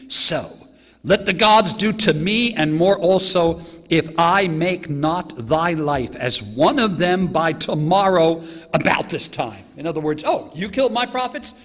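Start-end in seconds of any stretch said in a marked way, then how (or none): 0.1-9.6 loud, slow, raised-pitch vow; declaimed threat. Acted. Reading Jezebel's threat to Elijah. 10.3-11.5 high, loud indignant challenge; acted outrage. Acting out Jezebel's outraged threat.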